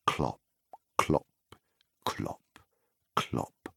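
clops fading to a soft, breathy whisper; hushed hoofbeats. A narrator's reading. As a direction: let the hooves sink into a hush.

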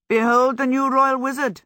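high, loud mock announcement; grand proclamation. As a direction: announce it like a herald.

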